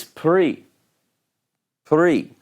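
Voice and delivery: pri with no vowel between p and r; pri, one quick syllable; Hebrew pri demo. Shown as a demonstration. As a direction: pri said short and clipped as a model word. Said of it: "this particular word".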